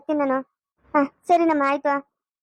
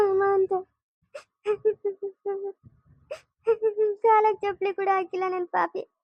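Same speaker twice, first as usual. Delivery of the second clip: tearful lament; 2 semitones higher than usual. Acted. Mother weeps for lost son.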